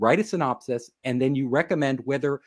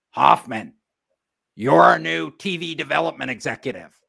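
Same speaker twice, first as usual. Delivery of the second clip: louder, heavy boss voice; producer's announcement; 4 dB louder than usual. Retold or imitated. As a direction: deliver the news like a big-shot boss.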